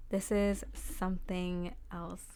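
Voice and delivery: slow, each word weighed, "This is something else"; slow emphatic repeat. Shown as a demonstration. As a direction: let it sink in slowly: "This is something else".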